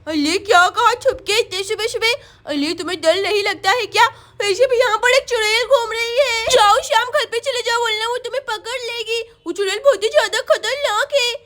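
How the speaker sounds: put-on warning little girl. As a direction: warn a playmate in baby talk. Sonam warns Shyam. (चेतावनी देती तोतली बच्ची)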